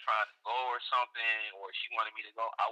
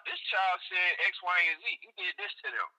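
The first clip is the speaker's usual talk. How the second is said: tipsy bar argument; 8 semitones higher than usual. Real, not acted. Heated argument, mimicking accusation.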